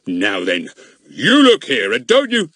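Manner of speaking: put-on angry policeman splutters at the boy; spluttering. A narrator's reading. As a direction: sputter with rising rage. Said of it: "He spluttered into the telephone".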